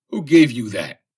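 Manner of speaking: sharp loud yell, pitch jumping up. A narrator's reading. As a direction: yell it out in accusation. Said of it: "he yelled".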